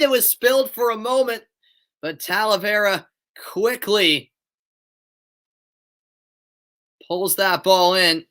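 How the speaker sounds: live match commentary voice; steady broadcast commentary; play-by-play. Acted. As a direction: call the game as it happens.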